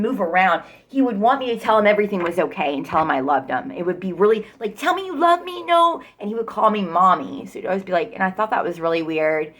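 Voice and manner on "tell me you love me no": high, pleading mock-whine of the boyfriend; whiny boyfriend voice. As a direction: mimic him begging for reassurance. Imitating clingy boyfriend's plea.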